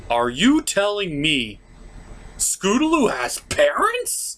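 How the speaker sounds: high, drawn-out disbelieving question; as if it can't be true; shocked disbelief. Real, not acted. Reviewer shocked that Scootaloo has parents, high and stretched.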